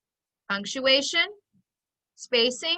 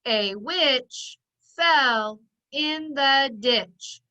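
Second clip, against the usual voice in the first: each word beaten out slowly and evenly; slow pounding so children join in; much slower than usual. Shown as a demonstration. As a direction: tap it out word by word for the class. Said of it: "Do it with me again".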